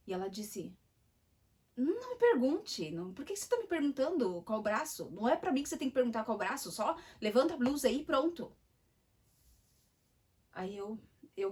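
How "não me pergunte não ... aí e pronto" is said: louder, higher, irritated nurse scolding; rude nurse snapping. Retold or imitated. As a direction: bark it at the patient like a rude nurse. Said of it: "ela foi muito estúpida desde o início".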